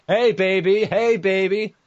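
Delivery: high loud come-on; mating call. Pretend. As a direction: squeal out a flirty call.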